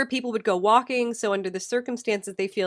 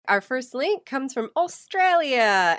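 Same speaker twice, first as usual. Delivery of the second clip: half-hearted Australian accent, slower, higher and louder, abandoned midway; attempted Australian accent; 4 semitones higher, 4 dB louder, slower than usual. Shown as a demonstration. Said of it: "I tried to do the accent but stopped in the middle".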